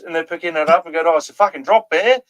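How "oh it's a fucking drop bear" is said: an Aussie yelping at a drop bear; Australian accent. Retold or imitated. Australian bloke pissed on by koala, fast high Australian accent. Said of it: "That's a pretty fucking good Australian accent".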